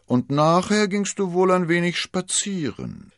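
high, loud, mocking insinuation; teasing suspicion. A narrator's reading. Athos probing what D'Artagnan did afterwards, teasing. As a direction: needle him with pretended innocence.

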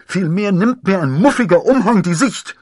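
high, loud protest through cloth; muffled under cloak. A narrator's reading. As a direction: call out from inside a cloak. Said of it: "tönte es dumpf unter dem Mantel". (dumpf unterm Mantel hervor)

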